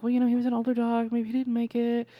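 high, put-on parent voice breaking bad news; consoling parent. Parents to Peter about the old dog, consoling. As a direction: let the child down gently.

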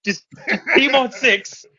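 hammed-up squeaky teen rallying the team; awkward kid's pep talk. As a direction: imitate a fumbling teen leader giving a speech. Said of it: "So you're just like".

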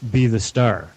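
normal talking voice

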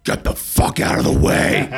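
low, unvoiced old woman growl; grumpy old lady shoving past; old lady snapping. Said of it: "a seventy-nine-year-old woman be like".

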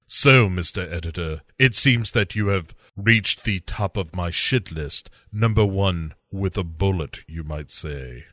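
low menacing villain voice; hammed-up villain. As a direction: gloat like a movie villain.

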